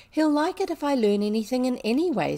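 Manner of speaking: rueful. A narrator's reading. Girl doubting she can please her father, rueful. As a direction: admit it with mild exasperation. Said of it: "said rueful Ermengarde".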